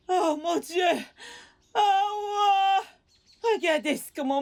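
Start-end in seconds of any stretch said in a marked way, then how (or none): 0.1-2.8 high, crying wail. Acted. Blinded mother wails at her fate, crying. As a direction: let it burst out as a cry.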